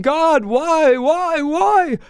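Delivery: mock lament. Grieving fathers cry out to God in mock lament. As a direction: cry out to heaven, half comic.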